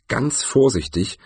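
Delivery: usual speaking voice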